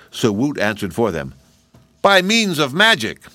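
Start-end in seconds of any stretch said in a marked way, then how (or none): 2.0-3.2 put-on boy's voice, calling. A narrator's reading. Woot answers through door.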